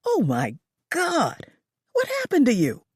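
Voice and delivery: shocked. A narrator's reading. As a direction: react to the blood. Lola gasps at blood.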